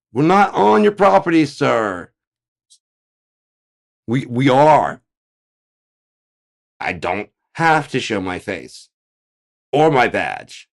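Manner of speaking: very high, loud indignant agent; masked agent yelling at homeowner, indignant; defensive agent shouting. Acted. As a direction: snap back like a cornered officer.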